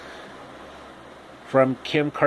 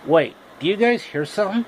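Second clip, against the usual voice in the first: startled question; 6 semitones higher, faster than usual. Acted.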